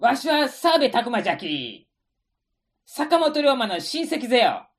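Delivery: bold Tosa dialect with じゃき and ぜよ. Acted.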